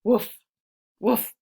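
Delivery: short, punchy mock bark; two playful woofs; dog bark. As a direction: bark like a friendly little dog. The dog barks in the story.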